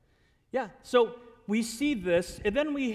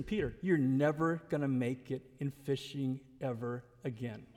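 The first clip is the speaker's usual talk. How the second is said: low, measured word to a friend; gentle firmness; 7 semitones lower, 4 dB quieter than usual. Acted. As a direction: lower the voice and let the last word fall away. Voicing Jesus gently telling Peter.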